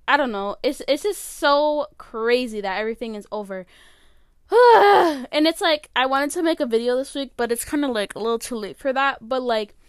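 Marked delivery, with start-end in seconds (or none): 4.5-5.2 loud, high, heavy exhale; long exasperated sigh; overwhelmed sigh. Real, not acted. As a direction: let out a big voiced sigh. Overwhelmed that everything is over.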